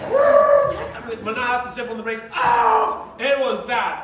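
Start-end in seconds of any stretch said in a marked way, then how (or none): 0.1-0.8 high, loud "woo"; whooped exclamation. Retold or imitated. Driver retelling being cut off, whooping. 2.4-3.1 high, loud "oh" of alarm; startled yell. Retold or imitated. Driver reliving a hard braking, startled yell. Retelling slamming on the brakes.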